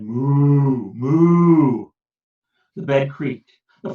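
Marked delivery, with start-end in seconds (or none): 0.0-1.9 long lowing moo; cow moo. A narrator's reading. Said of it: "The cow said".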